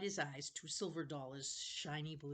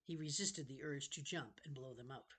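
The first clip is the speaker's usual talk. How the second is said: ordinary delivery, nothing marked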